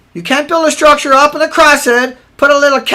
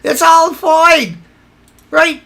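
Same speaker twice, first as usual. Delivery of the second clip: high mocking yell at a critic; sarcastic mimicry of an opponent; mocking shout; 6 semitones higher than usual. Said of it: "mocking opponent's claim".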